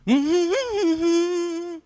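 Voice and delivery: long, loud quavering squeal; little girl's acted whimper; high-pitched wordless whimper. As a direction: whimper high instead of answering. Frightened girl's wordless answer.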